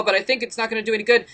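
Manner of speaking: ordinary talk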